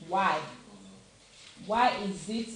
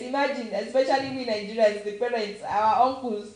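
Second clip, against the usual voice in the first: ordinary talk, nothing marked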